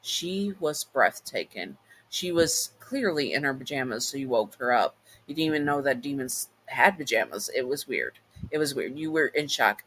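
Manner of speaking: ordinary delivery, nothing marked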